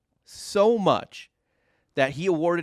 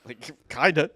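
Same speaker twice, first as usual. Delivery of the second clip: laughing; 5 semitones higher than usual. Real, not acted. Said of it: "laughs about mortgage".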